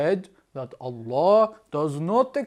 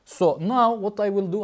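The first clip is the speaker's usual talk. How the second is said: usual speaking voice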